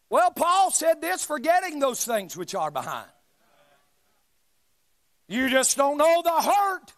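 forceful, loud-peaking sermon delivery; preaching. Real, not acted. Preacher pressing the congregation about hurt and storms, forceful preaching. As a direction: preach it hard, punching key words.

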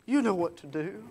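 a mother fighting back tears, speaking softly; quiet, teary mock of his mother; mother near tears. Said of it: "she's beginning to cry".

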